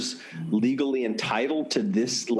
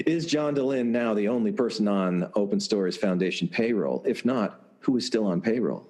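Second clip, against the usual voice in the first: normal talking voice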